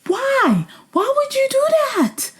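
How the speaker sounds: a high disbelieving rant at the contestants; exasperated shriek. Retold or imitated. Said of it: "I'm like".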